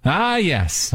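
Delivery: usual speaking voice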